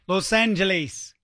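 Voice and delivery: "Los Angeles" ending in a long "leese"; slow "lohs AN-juh-LEESE" for "Los Angeles"; British pronunciation. Retold or imitated. Host imitating how Brits say it, "Los Angeles" ending like lease. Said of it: "What about the Brits?".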